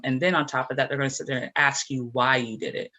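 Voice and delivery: usual speaking voice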